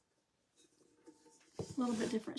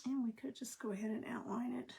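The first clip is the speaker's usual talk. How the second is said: whispering; 8 dB quieter than usual. Real, not acted. Quietly concentrating while painting.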